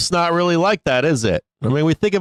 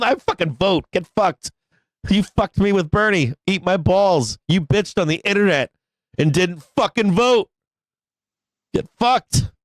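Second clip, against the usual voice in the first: high-pitched indignant comeback, normal volume; mock-angry rant; 6 semitones higher than usual. Streamer telling off liberals who didn't vote, mock-angry. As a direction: rant back at them in hammed-up outrage. Acts out retort to online attackers.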